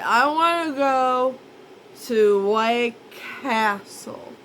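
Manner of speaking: dreamy craving for burgers; stoned craving. Retold or imitated.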